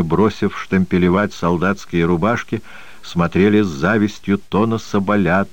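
ordinary delivery, nothing marked

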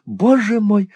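high, loud dismayed cry of боже мой; first боже мой thrown up high in alarm; dismayed exclaim. A narrator's reading. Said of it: "воскликнула мисс Лакриви". (испуганно восклицает высоко и громко)

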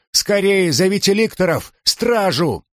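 high, urgent call for guards; urgent shout. A narrator's reading. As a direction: shout for help.

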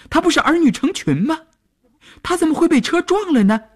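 very high, loud shrieks of disbelief; blustering shout. A narrator's reading. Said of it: "咋咋呼呼地喊道".

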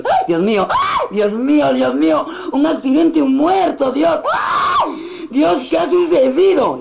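high, wailing camp exclamations; hammed-up effeminate man. Effeminate man at an accident trying to attract, camp wailing. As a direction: overplay the shock, far up in pitch. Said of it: "por conquistar a los negros".

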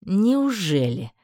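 drawn-out, very slow sneer; mocking drawl. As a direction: drag the word out mockingly. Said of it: "издевательски протянул магистр".

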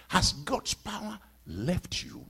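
ordinary delivery

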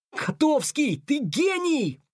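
high, drawn-out burst of admiration; delighted exclamation. A narrator's reading. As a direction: exclaim in delight at a brilliant idea. Delighted praise in voiced dialogue. (восторженный высокий протяжный возглас)

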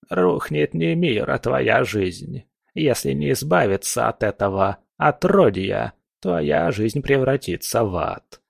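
put-on slow, ominous old fortune teller; a lightly voiced old witch; old crone voice.

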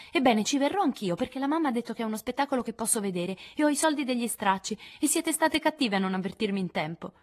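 Amy decisa, voce più alta; risoluta. A narrator's reading. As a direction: impunta i piedi, risoluta. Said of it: "aggiunse risoluta". (tono deciso e ostinato)

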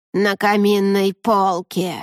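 slow, creaky raven voice. A narrator's reading. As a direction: drawl it out like a sly raven. The raven speaks.

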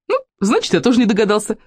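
put-on high, boyish excitement; delighted cry. A narrator's reading. Вовка marvels that nobody guessed, excited cry. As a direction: chirp it in delighted wonder. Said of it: "с восхищением продолжал кричать Вовка".

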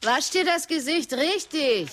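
mother's instruction. A narrator's reading. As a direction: tell your son gently but firmly. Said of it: "sagt sie im Bad zu ihm". (Mutter zum Sohn im Bad: leicht höher, mahnend)

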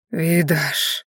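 weak, slightly hoarse call. A narrator's reading. Dying Maiari gets Viedash's attention, mildly hoarse. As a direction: call the name with a touch of hoarseness. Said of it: "услышав хриплый голос Маяри".

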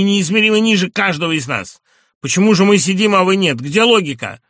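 loud, high indignant rant; a patient's loud, high indignant outburst; angry tirade. A narrator's reading. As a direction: hammer the doctor with loud accusations.

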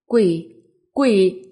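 loud, panicked yell; terrified cry. Acted. Frightened boy fleeing the toilet from a ghost, terrified shout. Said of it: "sợ tới mức".

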